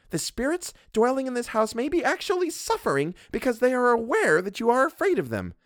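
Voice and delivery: a domineering wife scolding her husband; Mrs Montague. Acted. Mrs Montague lectures the doctor, haughty and bossy.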